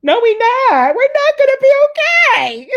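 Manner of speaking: high, loud, despairing wail; wailing protest. Retold or imitated. Answering her mother after the death, a high loud wail. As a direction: cry out that nothing will be okay. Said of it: "I'm looking at her like".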